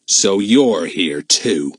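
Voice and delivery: very slow, menacing villain; cold, grim. A narrator's reading. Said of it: "said Joe in a cold, grim voice".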